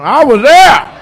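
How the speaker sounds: put-on high, loud old-timer boast; old man catchphrase. Old man bragging to the crowd, high and loud. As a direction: shout it like a proud old geezer.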